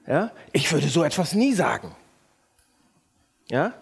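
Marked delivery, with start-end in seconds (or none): none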